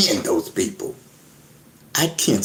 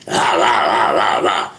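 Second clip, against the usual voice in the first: mock hype shouting; 7 semitones lower than usual. Vlogger mocking emotional ranting, mild mock shouts. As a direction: mimic hot-headed yelling. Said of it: "I'm not emotional".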